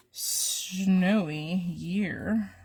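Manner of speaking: ordinary talk, nothing marked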